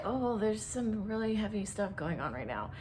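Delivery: mock hushed, wary aside; imitating uneasy onlookers. Onlookers reacting to someone crying hard, mock uneasy hush.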